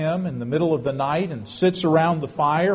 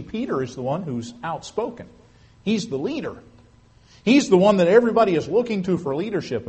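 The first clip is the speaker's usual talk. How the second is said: ordinary talk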